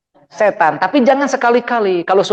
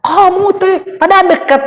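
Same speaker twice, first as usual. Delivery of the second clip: high, louder mock shout at someone close by; mock angry yell; 10 semitones higher, 3 dB louder than usual. A preacher imitating an angry person yelling at someone nearby, mock shouting. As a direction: playfully shout like someone in a rage.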